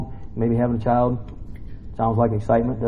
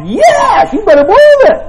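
much higher and louder, zealous; fervent loud yes; 10 dB louder than usual. Retold or imitated. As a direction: shout it with over-eager zeal. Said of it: "I'm like".

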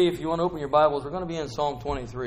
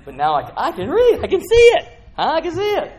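much higher and louder, laughing with delight; excited; 12 dB louder than usual. Real, not acted. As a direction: burst out joyfully that you can see. New glasses, suddenly sees clearly.

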